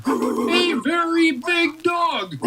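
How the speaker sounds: slow and high, stressing very big; emphatic. A narrator's reading. Narrator stresses how big the barking dog is, slowly. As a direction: stretch out the size of the dog.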